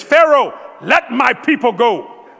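high loud slow command; loud prophetic command; Moses commanding. Retold or imitated. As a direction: thunder it at the king. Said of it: "Who said this?".

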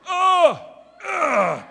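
loud, high straining groan; hammed-up grunts of getting up; effortful groans. Preacher mimics aging body getting up, loud groaning. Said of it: "didn't make any sound effects".

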